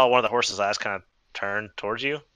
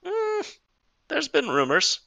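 lower horse voice; 3 semitones higher, 4 dB quieter than usual. Acted.

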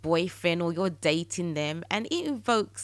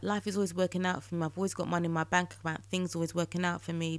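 fast, softer, dutiful rattle; rattled-off affirmations; 5 dB quieter, much faster than usual. Shown as a demonstration. Podcaster rattling off routine affirmations, fast and flat. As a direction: reel off the lines like a chore. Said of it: "blah, blah, blah".

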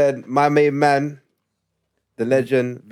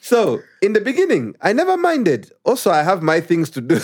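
put-on Ugandan accent; 6 semitones higher than usual. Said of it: "when I read it in Ugandan accent".

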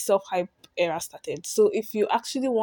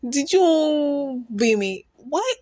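outraged; 6 semitones higher, 5 dB louder, much slower than usual. Real, not acted. Outraged slow question.

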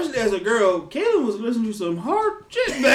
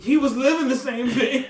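ordinary talk, nothing marked

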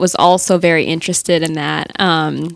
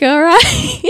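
laughing; 11 semitones higher than usual. Real, not acted. Awkward reply to stranger.

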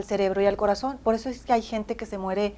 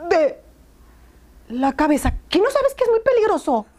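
indignant high-pitched rebuke to the nurse; angry scolding; 7 semitones higher than usual. Retold or imitated. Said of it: "pero enojada".

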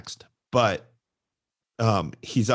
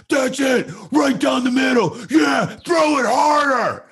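much higher, loud cheering shout; shouting coach; 14 semitones higher, 6 dB louder than usual. Retold or imitated. Rival coach cheers his pitcher from the dugout, loud shout. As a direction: yell it from the bleachers at your pitcher. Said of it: "says the kid's name and goes".

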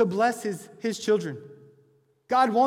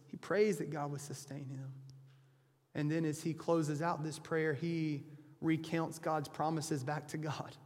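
ordinary talk, nothing marked

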